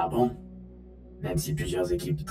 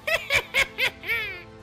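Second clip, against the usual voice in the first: mad scientist laugh. Acted. Podcast intro mad laugh.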